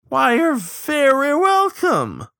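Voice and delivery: very high, loud, drawn-out sing-song; gloating mock graciousness. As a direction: relish the thanks, high and slow. Gloating reply to forced thanks.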